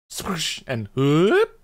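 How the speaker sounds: drawn-out swoosh and whoop, very slow; mock sound effects. Shown as a demonstration. Narrating the tea-making with slow, drawn-out mouth sound effects. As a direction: stretch each sound effect out slowly, like a cartoon. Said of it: "So much mileage with these sound effects".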